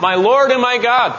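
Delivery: high, fervent exclamation; crying out. Acted. Disciple on his knees before his Lord, crying out. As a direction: cry it out in awe. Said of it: "crying out".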